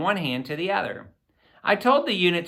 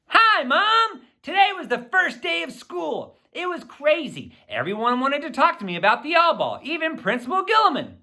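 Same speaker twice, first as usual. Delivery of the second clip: put-on much higher, bubbly excited kid; excited email reply; 10 semitones higher than usual. A narrator's reading. Billy writing back to his mom, excited and much higher. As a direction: gush the news to Mom, pitched up and eager.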